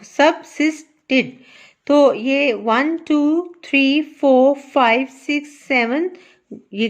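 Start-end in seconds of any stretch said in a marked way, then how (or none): 0.0-1.2 "subsisted" as sub-sis-ted, short gaps; syllable by syllable. Shown as a demonstration. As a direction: show how to read "subsisted" in three beats. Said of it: "इस तरह पढ़ना है इसको". ("subsisted" तीन हिस्सों में साफ़-साफ़)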